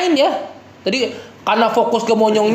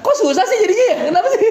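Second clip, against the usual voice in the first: laughing; 10 semitones higher, much faster than usual. Real, not acted. Laughing at struggling students.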